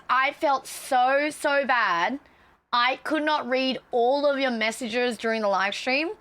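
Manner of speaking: normal talking voice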